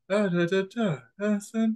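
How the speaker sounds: put-on a small, held-back childlike voice; shallow high voice. Shown as a demonstration. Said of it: "my voice is just kind of like".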